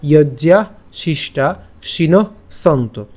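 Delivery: "যজ্ঞা শিষ্টা শিন সন্ত" slowly, word by word; pure Sanskrit pronunciation. Shown as a demonstration. Teacher goes through the broken-up verse, slow "যজ্ঞা শিষ্টা শিন সন্ত". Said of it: "উচ্চারণটা কেমন হচ্ছে".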